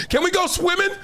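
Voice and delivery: put-on high-pitched child's plea; kid asking coach. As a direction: imitate a hopeful kid begging the coach. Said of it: "when I was that kid".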